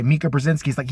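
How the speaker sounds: ordinary talk, nothing marked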